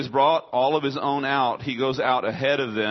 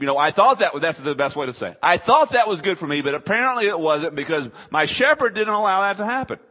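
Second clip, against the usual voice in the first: imitated sheep; 5 semitones higher, 6 dB louder than usual. Sheep imitated giving a good response.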